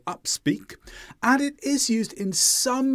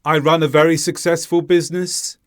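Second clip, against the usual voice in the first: robotic and louder, level tone right through BUSINESS; flat intonation; 6 dB louder than usual. Shown as a demonstration. Teacher showing learners a robot-like delivery, flat through BUSINESS. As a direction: say it without thinking, no rise or fall up to BUSINESS. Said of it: "the intonation was flat".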